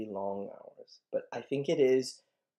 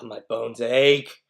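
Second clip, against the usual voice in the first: loud, drawn-out moan of complaint; aching complaint; 11 dB louder, slower than usual. Acted. Nurse stalling Juliet over her aching bones, hammed-up complaint. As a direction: play up the aches, slow and loud.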